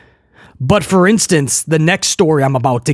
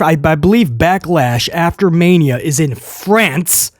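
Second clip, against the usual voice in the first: usual speaking voice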